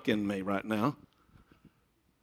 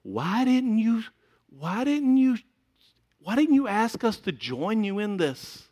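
high, pleading elders voice; elders asking; 10 semitones higher, slower than usual. Retold or imitated. Elders ask the pastor why he left them out, high and pleading. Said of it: "they're saying to me".

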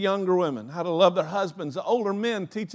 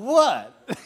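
bewildered what; 6 semitones higher, 6 dB louder than usual. Retold or imitated. Retold reply to rebuke.

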